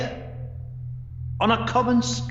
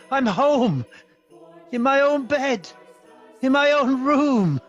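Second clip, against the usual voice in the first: elated, higher-pitched exclaiming; delighted disbelief; joyful relief; 3 semitones higher than usual. Acted. Wakes joyfully in own bed.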